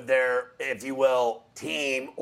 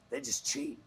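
conspiratorial whisper; whispered aside; 10 dB quieter than usual. Real, not acted. Pundit confiding about Baton Rouge rivals, whispered. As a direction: let them in on the secret, hushed.

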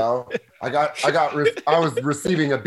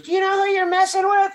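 tough woman voice. Retold or imitated. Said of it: "She goes".

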